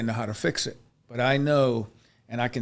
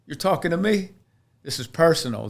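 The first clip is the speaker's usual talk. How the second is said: startled realization; 6 semitones higher, 6 dB louder than usual. Retold or imitated. Realizing the sermon speaks to him.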